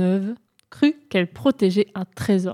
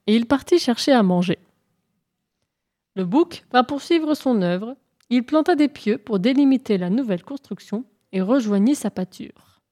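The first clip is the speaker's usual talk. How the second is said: plain reading voice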